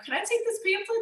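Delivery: high, overly polite request to a clerk; asking permission. Retold or imitated. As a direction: ask the store clerk with exaggerated politeness. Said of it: "I'd say".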